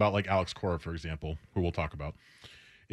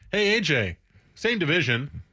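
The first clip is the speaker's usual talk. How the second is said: ordinary talk, nothing marked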